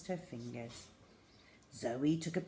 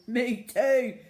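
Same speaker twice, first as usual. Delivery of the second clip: laughing; 6 semitones higher, 10 dB louder than usual. Acted. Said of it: "laughed Tina".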